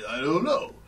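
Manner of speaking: slurred movie-boxer impression; Sylvester Stallone. Shown as a demonstration. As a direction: do a mumbly action-star voice. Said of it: "he does kind of talk like".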